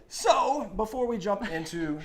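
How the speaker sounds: big announcer energy; infomercial voice. Real, not acted. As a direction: pitch it like a TV ad. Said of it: "You sound like an infomercial".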